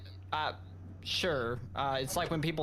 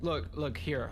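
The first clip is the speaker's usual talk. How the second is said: usual speaking voice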